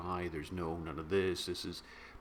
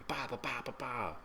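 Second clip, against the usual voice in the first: soft mouth-made gunshot noises; softly imitated bang sounds; mock gunshots; 4 semitones higher, 5 dB quieter than usual. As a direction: pop out three quiet shots.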